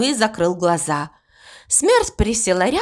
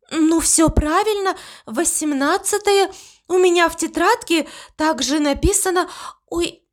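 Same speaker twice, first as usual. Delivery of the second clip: higher, confident then caught out; young Death girl; 7 semitones higher than usual. A narrator's reading. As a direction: sure of herself until the slip. Death checks the form.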